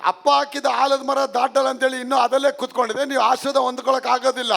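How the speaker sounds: driving, exhorting pulpit voice; fervent preaching. Real, not acted. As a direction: preach with mounting force toward a peak. Preaching at full force.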